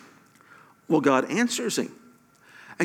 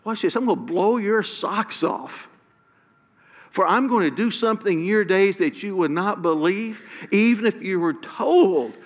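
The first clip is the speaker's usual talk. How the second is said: higher, eager, selling a big surprise; lightly voiced excited God; 4 semitones higher than usual. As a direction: hype it up like a thrilling secret. Voicing God, excited and high.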